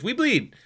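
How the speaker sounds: high-pitched mimicked gadget voice; imitating gadget's recorded call. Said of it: "ten seconds it goes".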